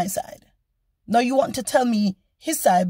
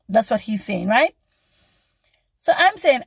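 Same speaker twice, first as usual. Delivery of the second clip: ordinary talk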